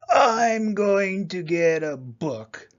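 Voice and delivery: slow, drawn-out boar voice; big, slow declaration; menacing boar. A narrator's reading. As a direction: announce it like a threat, word by word. Boar announces his plan ominously.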